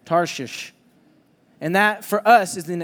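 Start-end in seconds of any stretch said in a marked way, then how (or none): 0.0-0.7 "Tarshish" said slowly and carefully; spelling it out. Shown as a demonstration. Pastor teaching how to say Tarshish, careful and deliberate. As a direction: teach "Tarshish", each sound clear. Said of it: "I'm gonna teach you right now".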